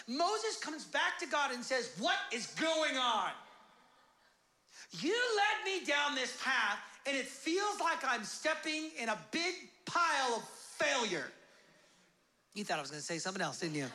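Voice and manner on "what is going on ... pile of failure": exasperated complaint. Acted. Moses complains to God.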